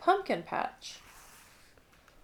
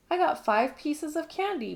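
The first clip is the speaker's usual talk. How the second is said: higher, proud, showing-off lilt; bragging; 7 semitones higher than usual. A narrator's reading. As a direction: boast it to the others. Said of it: "brags Lucy".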